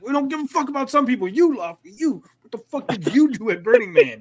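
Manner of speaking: hammed-up mocking rant, voice pushed up; mock angry rant. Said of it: "He's like".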